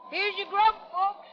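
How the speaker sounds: loud, high hearty announcement of the grub; hearty call. Acted. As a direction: barges in calling out cheerfully. Calamity bursting in with food.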